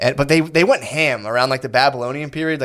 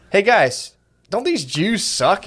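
higher, slow, sly conspiratorial pitch; mock scheming duke; 7 semitones higher, much slower than usual. Retelling the history lesson, duke rallying peasants slyly. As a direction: wheedle the crowd into agreeing. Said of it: "they'd be like".

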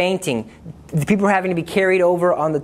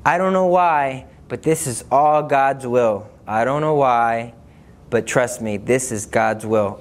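slow, grave delivery, dropping lower; very serious; 3 semitones lower, slower than usual. Retold or imitated. Answering a frightened girl in the crowd, very seriously. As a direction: say it solemnly, with measured weight. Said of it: "I looked at her very seriously".

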